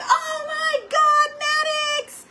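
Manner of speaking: high, slow wail of "Oh my God, Maddox!"; yelling at her son over the spill; exasperated cry. Retold or imitated. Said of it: "I was like".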